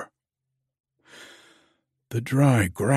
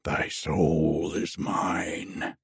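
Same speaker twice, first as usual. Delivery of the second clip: put-on low, slow, ominous old man; grizzled old man; 5 semitones lower, slower than usual. A narrator's reading. Old man claiming the dreamer's soul, low and menacing. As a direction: intone it lower, drawing out each word. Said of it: "A grizzled old man".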